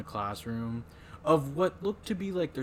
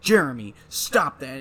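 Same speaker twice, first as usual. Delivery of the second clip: louder mock scold of a pupil; teacher scolding; 2 semitones higher, 10 dB louder than usual. Teacher scolding Jeremy in class, louder mock rebuke. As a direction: snap at the kid like a teacher. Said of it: "you just hear the teacher like".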